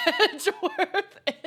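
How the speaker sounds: slow, cracking up mid-line; laughing. Real, not acted. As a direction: read it through real laughter. Cracks up rereading game line.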